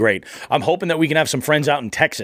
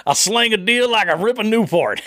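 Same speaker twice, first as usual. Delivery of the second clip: high, hammed-up Midwest cowboy accent; 9 semitones higher than usual. Host mimics a guest in a mock Midwest cowboy accent. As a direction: put on a Midwest cowboy twang for a buddy's line. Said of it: "I don't know why I gave Cody that accent".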